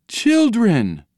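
high-pitched, astonished gasp of recognition; gasped cry. A narrator's reading. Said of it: "they gasped".

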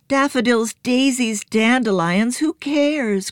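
put-on high, eager boy's list; excited cry. A narrator's reading. Joe cries out to the others, high and eager. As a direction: toss the flowers aside, eager to move on. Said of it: "cried Joe".

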